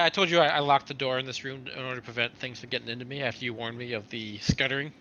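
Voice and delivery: ordinary delivery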